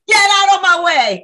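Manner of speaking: high, loud, full-out yell; furious shout. Acted. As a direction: yell to force a way through. Protester at peak of confrontation.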